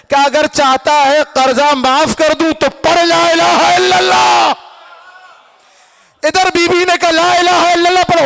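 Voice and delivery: rousing congregational oratory; full-force preaching. Acted. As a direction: drive up to a roaring, drawn-out climax. Preacher builds to shouted climax.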